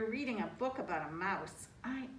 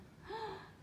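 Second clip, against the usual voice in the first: mouse gasp; 12 semitones higher, 9 dB quieter than usual. Acted. Mouse sees the statues back.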